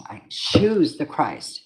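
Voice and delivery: "choose" stretched out slow and weighty; slow it right down, lean into "choose"; slow solemn declaration. Real, not acted. Repeats declaration slowly.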